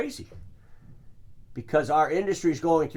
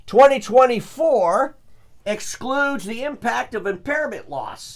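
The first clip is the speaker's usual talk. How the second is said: loud, high, slow read-out of the fine print; emphatic read-out; 7 semitones higher, 7 dB louder, slower than usual. Retold or imitated. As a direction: read it out slowly and pointedly.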